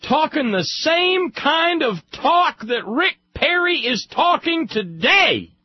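building to a loud, high-pitched peak; indignant outburst. Real, not acted. Speaker denouncing hotheads who talk like Rick Perry, indignant. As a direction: climb in pitch and volume with outrage.

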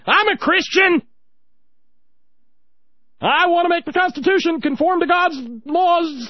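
hammed-up preacher yelling for votes; mock shouting preacher. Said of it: "Mike Huckabee standing over in the corner going".

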